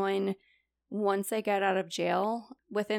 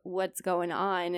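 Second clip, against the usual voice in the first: ordinary talk, nothing marked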